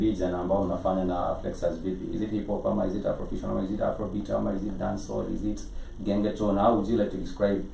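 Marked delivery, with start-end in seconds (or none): none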